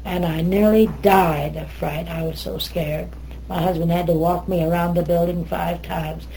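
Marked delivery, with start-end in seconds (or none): none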